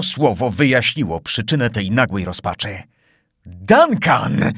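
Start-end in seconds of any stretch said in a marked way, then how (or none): 3.5-4.5 a raised cry of "Duncan!"; dismayed cry. A narrator's reading. Glenarvan recognizes the pirate yacht, dismayed cry. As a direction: cry out in shock. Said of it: "zawołał Glenarvan".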